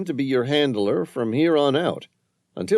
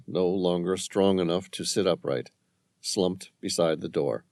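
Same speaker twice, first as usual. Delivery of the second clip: usual speaking voice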